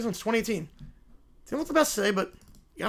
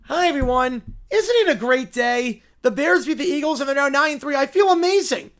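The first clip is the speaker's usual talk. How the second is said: higher, chipper bear greeting everyone; upbeat cartoon-bear cheer; cheerful bear; 4 semitones higher, 6 dB louder than usual. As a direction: play a little bear having a great day.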